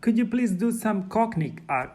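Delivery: ordinary talk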